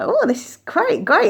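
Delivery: bursting up high, thrilled "Oh, this is great"; excited quote. Retold or imitated. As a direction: gush with delight at finally meeting her. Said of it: "I was like".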